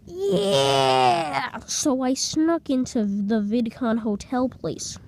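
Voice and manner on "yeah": long, high drawn-out cheer; hammed-up exclamation. Storyteller cheering his own realization, hammed-up excitement.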